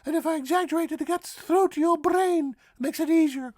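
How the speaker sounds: very high, squeaky cartoon mouse voice; high-pitched, playful teacher; Mickey Mouse. Shown as a demonstration. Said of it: "talking like Mickey Mouse".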